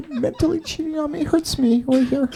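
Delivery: high, small, hammed-up hurt voice; mock wounded girlfriend. Mocks the offended ex, hurt.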